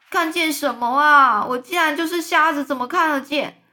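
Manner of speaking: shouting. Acted. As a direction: yell it in exasperation. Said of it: "蚯蚓又大叫说". (蚯蚓气愤地高声大叫)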